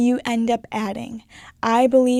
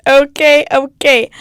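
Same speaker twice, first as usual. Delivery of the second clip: emphatic okay; 2 semitones higher, 10 dB louder, slower than usual. Real, not acted. Breaking the mock solemnity.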